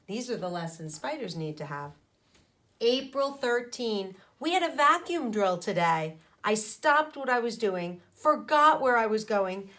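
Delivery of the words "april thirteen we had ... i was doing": plain reading voice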